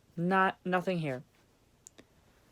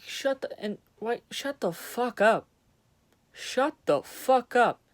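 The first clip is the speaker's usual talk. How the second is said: slow, louder, higher shout of shut the fuck up; angry yell; 6 semitones higher, 4 dB louder than usual. Real, not acted. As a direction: bark it out slowly, fed up.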